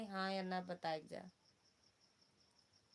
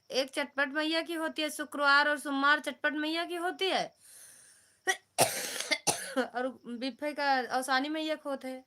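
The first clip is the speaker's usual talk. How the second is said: raised voice calling out across the room; loud; 5 semitones higher, 11 dB louder than usual. Real, not acted. Loudly telling Poonam sister about the mata pujas, loud raised voice. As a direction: explain it loud so the whole live hears.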